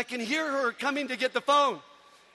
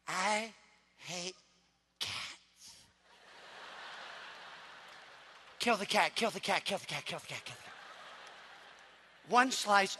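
put-on creepy kid voice; 4 semitones lower, 6 dB quieter than usual. Little boy's creepy cat talk.

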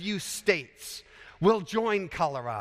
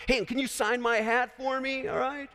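eager fan; 6 semitones higher than usual. Pretend. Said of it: "Somebody comes up to him and says".

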